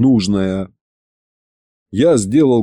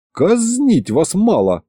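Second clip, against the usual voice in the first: grumbling; 6 semitones higher, slower than usual. Acted. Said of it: "проворчал я".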